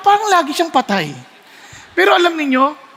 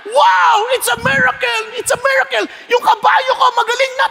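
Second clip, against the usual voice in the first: amazed owner; 11 semitones higher than usual. Acted. Owner seeing his horse healed, high astonished shout. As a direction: marvel at a miracle.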